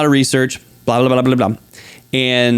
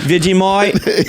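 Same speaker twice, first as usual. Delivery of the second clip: put-on slow, higher Australian accent; drawn-out Australian accent; Aussie Vegemite; 5 semitones higher, slower than usual. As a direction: stretch the word out Aussie style. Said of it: "Aussie word echo".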